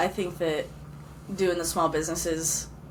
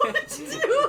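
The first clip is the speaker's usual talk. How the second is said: high laugh through the words. Real, not acted. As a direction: giggle while asking. Laughing at embarrassing story.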